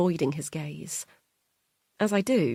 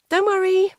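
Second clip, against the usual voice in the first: much higher, louder sing-song carer voice; chirpy carer calling on the phone; high bright phone voice of a carer; Annabelle the carer; 8 dB louder than usual. Acted. Carer speaking.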